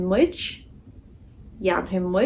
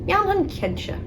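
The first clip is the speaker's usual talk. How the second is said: high, eager pretend answer; playful high-voiced yes; cheerful high reply; 9 semitones higher than usual. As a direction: chirp the answer like an obliging friend. Role-play answering a request.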